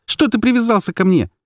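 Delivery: high, exasperated outburst; heated, wailing reproach; hurling accusations. A narrator's reading. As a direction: fire accusing questions, pitched high. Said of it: "сыпала я, задыхаясь обвинениями".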